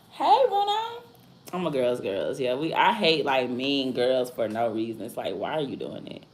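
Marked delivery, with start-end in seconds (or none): 0.1-1.0 sudden squeaky high call to the pet. Real, not acted. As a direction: call Bruno in a high, sing-song voice. Calling out to a pet.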